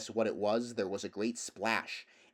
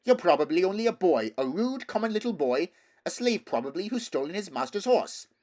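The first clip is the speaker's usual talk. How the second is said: put-on scornful girl; 8 semitones higher, 7 dB louder than usual. Girl insulting Shasta.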